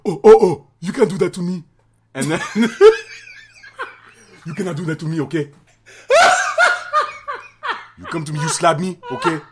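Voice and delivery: loud, high mock Haitian accent, laughing through it; bad Haitian accent. Relative complaining about being slapped, loud bad Haitian accent. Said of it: "that's a shitty Haitian accent".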